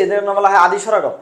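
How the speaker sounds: ordinary talk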